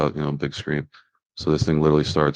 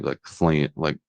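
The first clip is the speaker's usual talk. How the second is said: ordinary delivery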